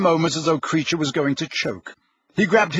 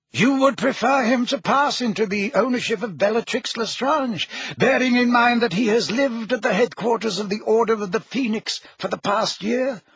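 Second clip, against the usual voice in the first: put-on higher, reasoning old wizard; lightly voiced old wizard; 7 semitones higher than usual. A narrator's reading. Headmaster reasoning with Harry. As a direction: ask it patiently. Dumbledore voice.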